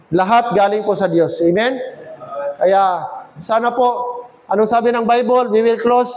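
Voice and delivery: ordinary talk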